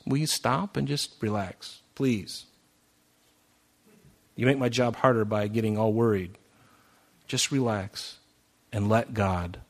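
soft, calm, reassuring; gentle God's voice. Retold or imitated. God speaks to anxious Rob, soft and reassuring. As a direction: soothe him into letting go. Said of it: "He says".

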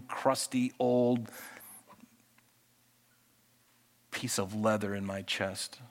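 soft, humble prayer to God; hushed prayer. Acted. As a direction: pray quietly, almost under your breath. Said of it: "Then I have to pray".

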